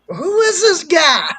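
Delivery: loud, high, drawn-out question; hammed-up bafflement, slow and loud; retold incredulous outburst. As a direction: cry out in mock disbelief. Retold outburst about unknown caller.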